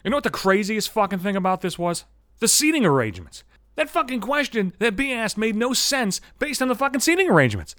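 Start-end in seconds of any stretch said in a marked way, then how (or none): none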